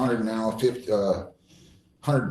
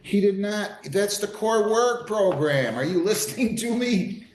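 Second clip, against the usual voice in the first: exasperated; 9 semitones higher than usual. Real, not acted. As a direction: demand attention, voice pitched way up. Judge scolding the confused defendant.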